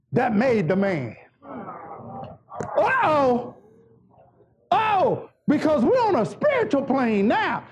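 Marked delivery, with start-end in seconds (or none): none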